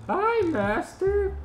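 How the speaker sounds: very high, drawn-out squeaky greeting; slow falsetto Gur voice; Gur impression. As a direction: attempt a high-pitched little robot. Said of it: "none of us can do a Gur impression".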